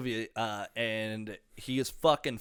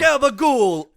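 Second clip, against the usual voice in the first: hammed-up gabagool, higher and louder; mock mobster; 11 semitones higher, 8 dB louder, slower than usual. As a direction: toss off a gangster catchphrase. Quoting Sopranos catchphrase.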